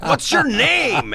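very high, drawn-out question; excited question. Retold or imitated. Impressed stranger asking the comic's name, slow and high. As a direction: ask like a thrilled new fan.

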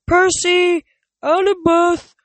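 loud high calling out names. Acted. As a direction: shout the names across the camp. People yelling names in distance.

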